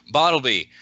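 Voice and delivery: mildly booming call from offstage. Acted. A voice booms out towards Bartleby, booming. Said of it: "a voice booms out".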